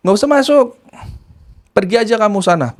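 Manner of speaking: high, curt voice of the nephew; hostile nephew. Retold or imitated. Nephew through a locked door telling his aunt to leave. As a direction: order her away through the door. Nephew refuses her entry.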